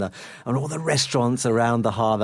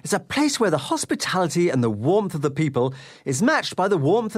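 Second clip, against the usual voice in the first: upbeat travel show host; 4 semitones higher than usual. Acted. Host opens travel segment.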